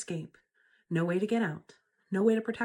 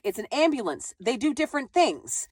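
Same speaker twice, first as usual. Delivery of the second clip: ordinary delivery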